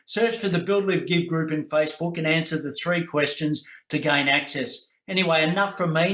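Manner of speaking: promo read. Acted. Host plugging the group to listeners, upbeat ad read. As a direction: pitch the Facebook group like a radio ad.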